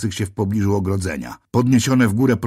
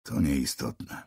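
much softer, lower, very slow and drained; tormented, dull voice; 8 semitones lower, 10 dB quieter, much slower than usual. A narrator's reading. Arrested narrator answers the policeman, drained and dull. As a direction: let the words sag with exhaustion. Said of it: "równie udręczonym, matowym głosem".

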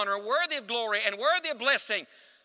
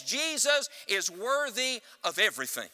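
usual speaking voice

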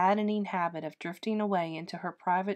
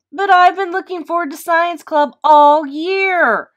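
high, much louder, slow protest on the edge of crying; rising, near tears; 9 semitones higher, 15 dB louder than usual. A narrator's reading. Boy pleading with mom, voice rising near tears. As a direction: let the voice climb as the tears come. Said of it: "Michael's voice rose".

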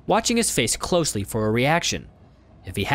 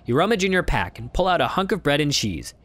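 ordinary talk, nothing marked

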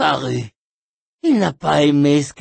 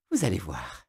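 ordinary talk

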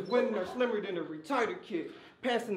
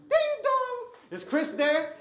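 sung high doorbell chime, much higher; doorbell ding dong; 13 semitones higher, 4 dB louder, slower than usual. Retold or imitated. Goons ringing at the rival's door, high sung doorbell chime. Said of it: "goons at your door like".

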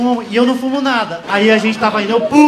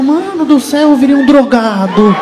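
mock panic; 2 semitones higher, 4 dB louder than usual. Panicked inner monologue about drugs.

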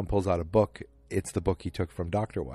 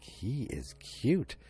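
slow, higher, softer dreamy mother voice; swooning mother; 5 semitones higher, 5 dB quieter, much slower than usual. Retold or imitated. Joan's mother admiring Bob after he leaves, slow dreamy swoon. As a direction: draw it out like a smitten mom.

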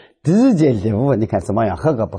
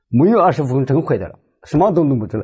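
ordinary delivery

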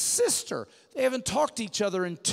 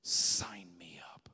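whisper; 8 dB quieter, slower than usual. Real, not acted. Whispered close to the sermon.

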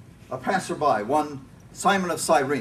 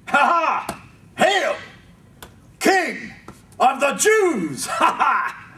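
high, loud jeering hail with cackling laughs; mocking soldiers; 11 semitones higher, 5 dB louder, slower than usual. A narrator's reading. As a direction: sneer it like a soldier making fun of a prisoner. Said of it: "finished mocking him".

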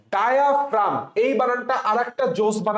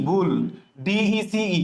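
ordinary talk, nothing marked

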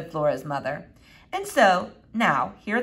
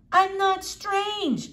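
put-on high, slow indignant girl; offended, drawn-out denial; indignant protest; 13 semitones higher, much slower than usual. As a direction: draw out the objection in a raised pitch. Girl protests being called strange.

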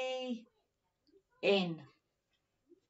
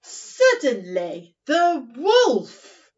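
dramatic storytelling; 3 semitones higher, 10 dB louder, much slower than usual. Acted. Storytelling build-up to wolf.

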